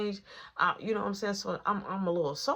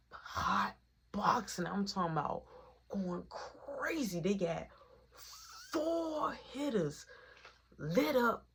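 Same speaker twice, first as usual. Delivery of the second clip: slow, stretched words for effect; drawn out; 3 semitones higher, slower than usual. Real, not acted. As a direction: savour every word slowly. Describing smoky car.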